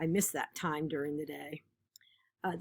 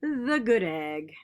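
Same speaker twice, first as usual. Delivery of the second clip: lively, expressive picture-book reading; animated read-aloud of a kids' book; reading to children; 2 semitones higher, 5 dB louder than usual. Acted. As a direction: read it like storytime for a class.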